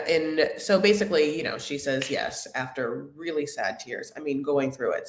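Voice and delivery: ordinary delivery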